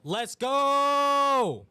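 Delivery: high, loud, drawn-out excited yell; loud cheer. Real, not acted. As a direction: belt it out like a fan, stretching the GO. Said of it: "Sorry if that was too loud".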